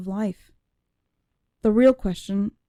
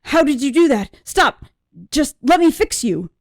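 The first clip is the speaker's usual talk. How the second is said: father's panicked plea, higher and louder; a father begging in alarm, pitched up; 6 semitones higher, 5 dB louder than usual. A narrator's reading. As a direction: cry out to stop her, frantic. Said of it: "in a panic".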